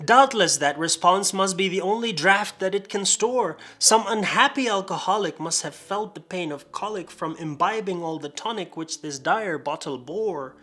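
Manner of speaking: rhythmic, theatrical verse reading; dramatic poem recital. Acted. Reading a drunken parody of The Raven, dramatic recital. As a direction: recite it with mock-gothic drama.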